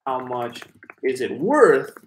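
"How much is it worth?" said slowly, landing on "worth"; a careful model of "How much is it worth?"; slow model sentence. Shown as a demonstration. Teacher demonstrating the phrase for a viewer. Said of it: "you can also say".

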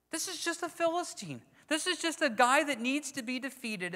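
David shrugging off the giant; dismissive scorn. Acted. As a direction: brush the giant off as nothing. Preacher voicing David's dismissal.

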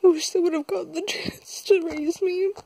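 tearful, high, breaking voice; fighting back tears while talking; after crying. Real, not acted. Breaks down telling of her mother.

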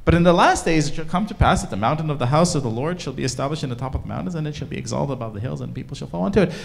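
fast run-through of a repeated verse; rattled-off scripture. Acted. Speaker showing Micah repeats Isaiah, rattled off quickly. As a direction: race through the prophecy without pausing.

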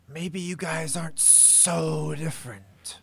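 lizard hiss. A narrator's reading. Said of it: "hissed the lizard referee".

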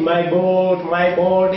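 normal talking voice

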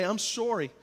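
Canadian "sorry", first vowel rounded like "sore-y"; accidental Canadian accent. Real, not acted. Pastor apologising to his child, slipping into a Canadian accent. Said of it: "I turn Canadian all of a sudden".

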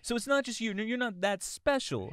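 high, loud put-on voice; hammed-up cartoon put-down; comic retort. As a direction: deflate them in a silly high voice. Acting out retort to turtle.

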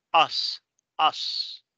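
"us" ending in a long hissed s; voiceless final S. Shown as a demonstration. Teacher repeating the word for learners, voiceless final S. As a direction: model "us" with a Spanish-style s, no buzz. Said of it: "se pronuncia como pronunciamos la S en español".

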